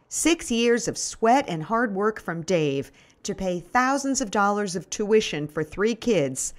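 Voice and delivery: plain reading voice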